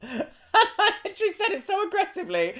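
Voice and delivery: high, squeaky laughing speech; cracking up at yourself; real laughing. Real, not acted. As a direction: talk through a laugh. Laughs at own retold line.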